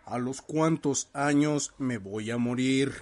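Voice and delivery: slow eerie question to the spirits; spooky Ouija question. Retold or imitated. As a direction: ask the board slowly, hushed. Pregunta a la tabla Ouija. (pregunta lenta y misteriosa)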